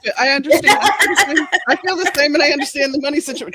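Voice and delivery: high, loud, laughing agreement. Real, not acted. Author laughing along with the host about being broke. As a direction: laugh through the words in shared sympathy.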